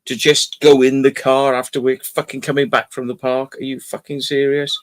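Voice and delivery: ordinary talk, nothing marked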